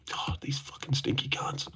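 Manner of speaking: hushed whispered insult under the breath. Real, not acted. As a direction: whisper it so chat barely hears. Muttered insult at chat.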